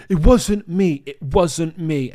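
high, loud, desperate it wasn't me; pleading denial. Retold or imitated. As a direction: plead your innocence, panicked. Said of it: "And I was like".

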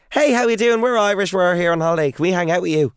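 put-on chatting up guys at a Miami hotel bar in an over-the-top Irish accent; hammed-up Irish accent. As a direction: lay the Irish on thick to charm strangers at a bar. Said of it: "Hammed it up".

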